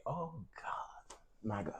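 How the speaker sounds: a shocked little whisper at the cold; whispered dismay. Real, not acted. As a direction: breathe out a whispered oh God. Reacting to the cold weather.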